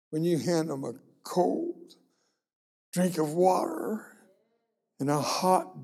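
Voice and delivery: rising, ringing preacher's cadence; preaching. Acted. Preacher tells the congregation what matters, ringing preaching. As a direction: build the sermon point with swelling pitch.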